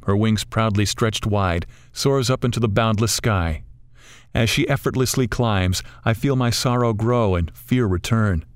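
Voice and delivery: ordinary delivery, nothing marked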